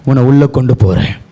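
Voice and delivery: very low, gentle promise; hushed-down preacher voicing the Lord; consoling voice of God. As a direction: drop deep to reassure her. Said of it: "கர்த்தர் சொல்லிட்டாரு".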